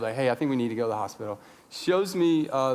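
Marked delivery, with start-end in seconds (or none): 0.1-1.4 low, quick, unruffled understatement; scary calm. Retold or imitated. Wife seeing daughter's hurt hand, scary calm. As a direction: say it low, as if nothing is wrong. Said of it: "in this scary, calm way".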